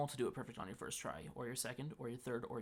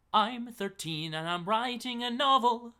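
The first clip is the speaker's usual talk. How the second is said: much higher, louder and slow; squeaky reading voice; 10 semitones higher, 11 dB louder, slower than usual. Pretend. Reading a teen viewer's question in a squeaky kid voice. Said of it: "reading viewer question in put-on voice".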